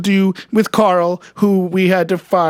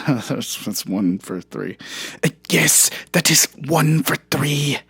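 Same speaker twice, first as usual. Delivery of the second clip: deep Herzog impression; 5 semitones lower, 3 dB quieter than usual. Deep Herzog voice while mocking the claim. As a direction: sound like a solemn German director.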